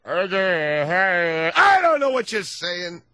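very slow, slurred mumble; lightly voiced mumbling pupil. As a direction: drag the words out groggily. Helen Keller mumbling reply.

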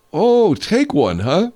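worked-up sarcastic comeback; indignant retort. Acted. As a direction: throw the suggestion back in outrage. Acted quarrel over taking a vacation.

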